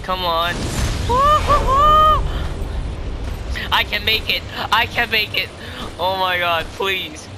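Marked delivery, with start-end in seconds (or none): 0.6-3.7 very high squealed whoops; drawn-out high hoot; high-pitched wail. Real, not acted. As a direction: shriek out in long broken bursts. High outburst during gameplay.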